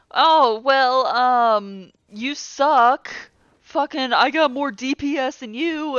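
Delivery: mocking jerk. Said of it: "this asshole that's just like".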